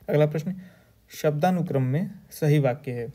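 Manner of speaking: usual speaking voice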